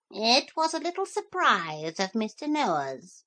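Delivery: chirpy bird sharing a secret; parrot. Acted. Parrot explains the dogs' arrival, chirpy and high.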